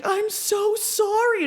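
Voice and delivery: high, slow, stretched-out apology; mock sorry. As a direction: whine the apology like a guilty kid. Apologising to double-booked friend.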